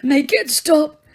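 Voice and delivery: high, slowing moan of a plea. Acted. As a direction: moan it out, fading. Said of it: "moaned Dumbledore".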